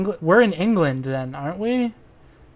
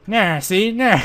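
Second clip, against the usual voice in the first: higher, drawn-out mock gangster sneer; put-on tough-guy voice; 4 semitones higher, 3 dB louder, slower than usual. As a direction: ham it up, stretching the last "nah". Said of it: "I can't pin down this guy's voice".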